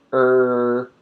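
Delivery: isolated Er held out; er sound. Shown as a demonstration. Teacher isolating the Er sound, drawn out. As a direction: say Er with the tongue pulled back. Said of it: "with the er sound".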